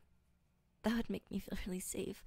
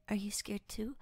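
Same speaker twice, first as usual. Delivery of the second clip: whisper. Acted. Whispered ASMR roleplay.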